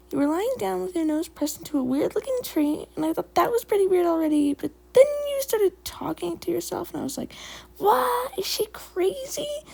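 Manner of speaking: high, swooping kitty pet voice, gossipy and incredulous; awkward chatty Skipper. A narrator's reading. Skipper explaining to Leaf Paw why friends came, high and awkward.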